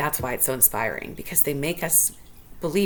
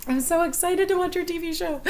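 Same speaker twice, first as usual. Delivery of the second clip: much higher, gushing fan squeal; squealing excitement; 13 semitones higher than usual. Shown as a demonstration.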